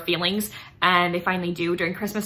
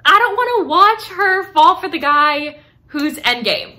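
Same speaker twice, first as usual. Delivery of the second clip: higher, louder, slow anguished whine; nervous dread; 8 semitones higher, 10 dB louder than usual. Real, not acted. Reader dreading the show's ending, higher louder dramatic whine. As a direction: drag it out like the thought hurts. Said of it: "that's why I'm nervous to watch the show".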